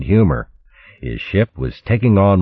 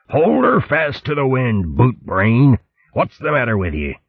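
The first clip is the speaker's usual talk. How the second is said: higher, bellowing corsair fox; roaring fox captain; 8 semitones higher than usual. Acted. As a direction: roar at the helmsman like a pirate captain. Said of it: "Chopsnout roared".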